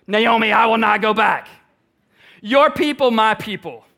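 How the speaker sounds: fierce devoted declaration; rising passion. Acted. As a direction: build the vow, climbing every line. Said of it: "hear her voice rising with every sentence".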